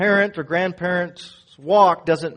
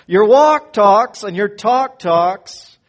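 slow and loud, each phrase punched; emphatic repeat; 4 semitones higher, 10 dB louder, slower than usual. Shown as a demonstration. Preacher repeating a saying for a puzzled congregation, slow and emphatic. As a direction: hammer out the saying slowly, loud and raised. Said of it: "You want me to say that again?".

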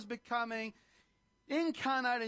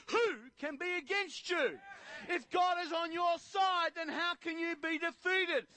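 high, ringing preacher's climax; fervent preacher rising higher and higher; preaching at full force; 6 semitones higher than usual. Acted. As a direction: build it up and lift them.

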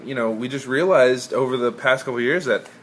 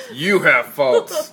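slow teasing imitation of her; mock her pointed tone, slowly; imitating; 2 semitones higher, 6 dB louder, much slower than usual. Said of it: "look how she said that".

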